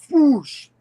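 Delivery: high, loud, drawn-out whoosh; imitated whoosh. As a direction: stretch out a comic-book whoosh. Repeats the impact sound effect.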